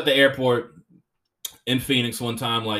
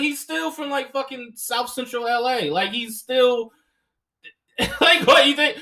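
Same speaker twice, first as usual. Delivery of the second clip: ordinary talk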